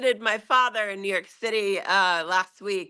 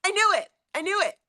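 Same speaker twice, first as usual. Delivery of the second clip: dad voice; 11 semitones higher, faster than usual. Retold or imitated. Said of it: "And he's like".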